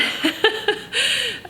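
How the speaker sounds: very high, embarrassed laugh; tearful giggle, trying to recover; laugh while regaining composure. Real, not acted. Vlogger moving on from her tears, laughing it off. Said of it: "I'm trying to compose myself".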